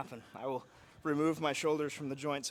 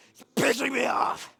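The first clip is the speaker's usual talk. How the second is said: strained shout; 12 semitones higher, 11 dB louder than usual. Real, not acted. Exhausted mid-set.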